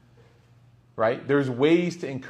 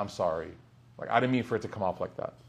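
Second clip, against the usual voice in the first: lower, softer, quick apology; sheepish apology; 5 semitones lower, 7 dB quieter, faster than usual. Retold or imitated. Visitor apologising to Imam Malik, softer and lower. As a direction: back down softly and quickly. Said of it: "he realizes he made a mistake".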